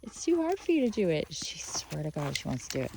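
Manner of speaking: ordinary talk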